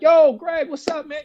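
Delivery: high, bright friendly shout of greeting; excited greeting. Retold or imitated. Teammates greeting Greg in a high excited voice. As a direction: players hailing an old friend. Players greeting him warmly.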